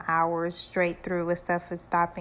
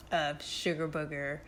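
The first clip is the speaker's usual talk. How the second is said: ordinary talk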